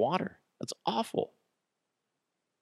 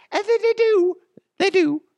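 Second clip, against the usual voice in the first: much higher, louder squeak; squeaky high voice; 7 dB louder than usual. Shown as a demonstration. Host squeaks the answer in his beer store ad, high and loud. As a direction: pipe it up high like a cartoon. Ad read, answering own question.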